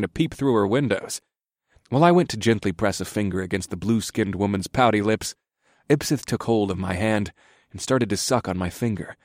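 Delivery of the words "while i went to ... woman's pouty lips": usual speaking voice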